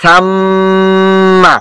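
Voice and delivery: সাম্মা with a long, steady hum on ম; held ghunnah. Shown as a demonstration. Teacher showing students how long to hold, সাম্মা with prolonged ম. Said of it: "ধরে রাখতে হবে".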